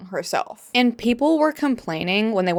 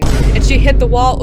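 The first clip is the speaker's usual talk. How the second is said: mock outrage; 5 semitones higher, 11 dB louder than usual. Exasperated mocking of critics.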